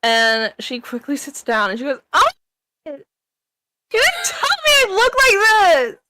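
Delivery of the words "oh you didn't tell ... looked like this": very high, loud, outraged shriek; shrill scream. Acted. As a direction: scream it in horror and throw them out. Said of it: "let out a shrill scream".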